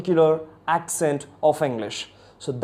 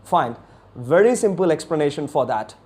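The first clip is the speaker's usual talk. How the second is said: ordinary talk, nothing marked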